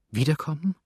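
put-on a touch of a trembling young girl; trembling cry. A narrator's reading. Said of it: "rief zitternd das junge Mädchen".

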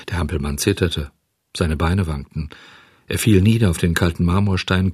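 plain reading voice

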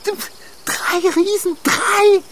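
horrified at three giants; shocked outcry. Acted. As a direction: squeal it in alarm.

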